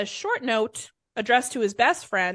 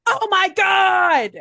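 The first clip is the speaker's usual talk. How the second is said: high, loud, drawn-out cry; top-of-lungs scream; 8 semitones higher, 7 dB louder, much slower than usual. Acted. Harvey jumping up at the news, screaming melodramatically. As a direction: scream it out slowly, hammed up. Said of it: "screamed at the top of his lungs".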